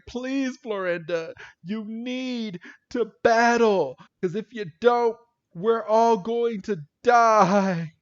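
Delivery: high, slow melodramatic begging; hammed-up pleading. Podcaster jokingly mimics Brock begging Florinda, melodramatic. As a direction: beg grandly, building to a drawn-out last word.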